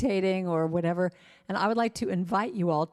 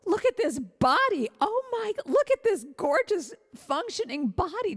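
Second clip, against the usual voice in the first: high, delighted gushing, slowed on the exclamations; overjoyed high squeals at your own body; gushing mirror praise; 7 semitones higher than usual. Pretend. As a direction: rave at your reflection like you can't believe it. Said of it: "look at yourself in the mirror and go".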